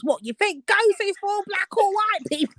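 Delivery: high, disbelieving question; incredulous. Real, not acted. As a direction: pitch way up in disbelief. Outraged retort.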